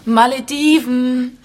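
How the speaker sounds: slow, loud, drawn out; pointed reminder. Acted. Wife reminding husband of their holiday, slow and pointed. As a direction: lean on it, slow and firm.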